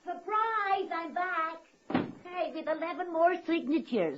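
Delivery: high, bright burst through the door; excited entrance. Acted. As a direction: announce yourself with a high surprise. Bursting in with news.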